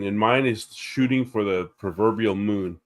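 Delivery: ordinary delivery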